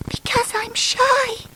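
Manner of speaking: high, slow, small voice; timid Miss Shy. Acted. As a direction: say it shyly, shrinking away. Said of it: "explained Miss Shy".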